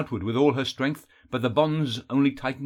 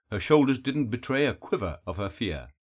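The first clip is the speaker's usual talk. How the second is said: plain reading voice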